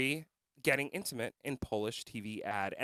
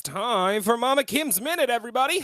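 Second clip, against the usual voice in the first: announcer voice; 8 semitones higher, 6 dB louder than usual. Acted. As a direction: announce it like a show host. Announcing segment title.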